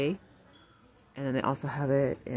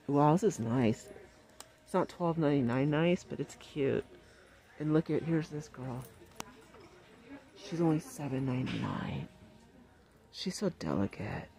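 ordinary talk, nothing marked

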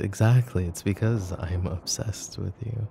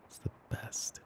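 breathy whisper close to the mic; soft whisper; 14 dB quieter than usual. Acted. Girlfriend savouring teasing her partner, whispering. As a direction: let it slip out as a secret.

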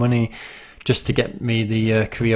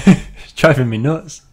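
laughing; 3 semitones higher, 5 dB louder than usual. Real, not acted. Laughing at own repeated word.

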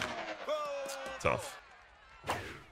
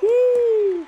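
high, loud cheer; thrilled yell; excited whoop; 7 dB louder than usual. Real, not acted. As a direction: whoop like the crowd just rose. Celebrating a hit.

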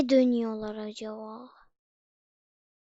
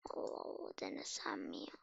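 much softer, unvoiced musing; whisper; 16 dB quieter than usual. Real, not acted. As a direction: whisper the doubt to yourself. Whispered hesitation over jump. (fısıldayarak tereddüt)

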